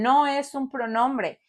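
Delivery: usual speaking voice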